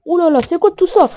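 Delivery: loud, high alarmed exclamation; effaré. Real, not acted. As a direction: react to a sudden horde. Sees crowd of mobs. (étonnement alarmé, aigu et fort)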